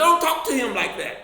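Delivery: loud, high angry retort; angry protest. Retold or imitated. Father scolding the teacher for her tone, loud and angry. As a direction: bark it back in defence of your son.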